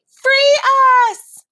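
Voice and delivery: high, loud plea; crying out. A narrator's reading. Caged geese begging the queen, high loud cry. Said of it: "the geese cried".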